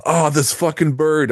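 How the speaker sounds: high, slow startled curse; a goose catches him off guard; coworker's startled outburst. Retold or imitated. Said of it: "he's like".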